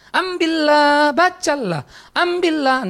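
high, drawn-out call; calling child's voice. Acted. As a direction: call it out like a child at play next door. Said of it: "suara itu mengganggu dia". (seruan anak bermain, tinggi dan panjang)

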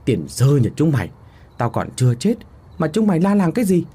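indignant scolding, building to a high, quick finish; angry outcry. A narrator's reading. Old man scolding those who thought him dead, angry outcry. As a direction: scold them crossly, jumping up in pitch at the end. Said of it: "lão tức quá mà kêu lên".

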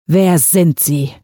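slow, choked-out question; forced out in fear. A narrator's reading. Terrified father struggles to ask the stranger, slowly. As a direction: squeeze the words out, barely managing. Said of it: "brachte René hervor".

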